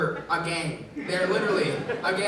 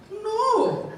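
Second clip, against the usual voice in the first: high, slow Indian mom voice; 11 semitones higher, slower than usual. Retold or imitated. Son asks mom about being called fat, she answers in a high mom voice. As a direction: imitate his mom, high and drawn out.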